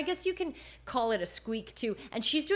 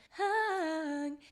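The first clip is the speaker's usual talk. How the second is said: breathy head voice; 5 semitones higher than usual. Shown as a demonstration. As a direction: hang sung high in airy head voice. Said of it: "that breathy head voice".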